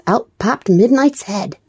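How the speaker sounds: usual speaking voice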